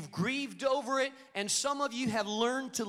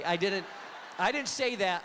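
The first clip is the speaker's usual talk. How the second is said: ordinary delivery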